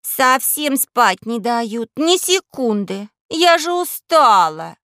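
put-on high, slow, loud whining girl; spoiled girl grumbling awake; whiny little princess. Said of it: "проворчала".

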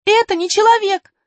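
put-on high, loud, urgent girl. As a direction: warn them urgently. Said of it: "сказала Алиса". (взволнованно)

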